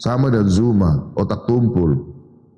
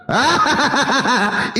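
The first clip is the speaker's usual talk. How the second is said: a short scornful laugh, pitched up; mocking laugh; 4 semitones higher than usual. Real, not acted. Apologist laughs at his challenger, mocking.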